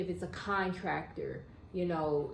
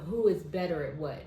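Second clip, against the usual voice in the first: ordinary delivery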